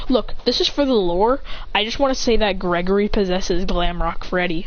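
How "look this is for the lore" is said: usual speaking voice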